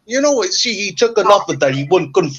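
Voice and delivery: high mock mafia boss, pleased; hammed-up mob boss; mobster. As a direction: gloat like a Sopranos boss. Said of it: "are we on an episode of The Sopranos now".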